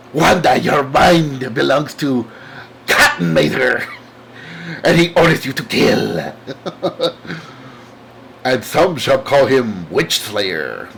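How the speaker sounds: slow, ominous proclamation; dramatic comic villain. Acted. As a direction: read it like a hammy comic-book villain. Hammy reading of comic villain lines.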